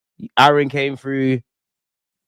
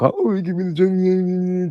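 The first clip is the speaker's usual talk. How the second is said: slow, higher whining plea, mocking rival fans; mock whiny rival fan; 7 semitones higher, 3 dB quieter, slower than usual. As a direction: drag it out like a crybaby begging for a trophy. Mocking rival fans begging for trophy.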